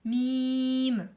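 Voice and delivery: Mim chanted long and loud, nasal held; recited mim. Shown as a demonstration. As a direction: hold the Mim like a recitation teacher. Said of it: "j'ai bien prononcé m".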